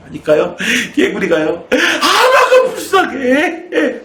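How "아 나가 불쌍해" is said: very high, slow, laughing wail of a sobbing frog; hammed-up sobbing frog, high and drawn out; crying frog voice. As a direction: whimper it like a weepy little frog through laughter. Crying frog answers, punchline.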